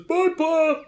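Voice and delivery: put-on high, loud child's holler; excited kid shouting goodbye; Elmo. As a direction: call back to Pa while running off. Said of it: "he starts running down the road".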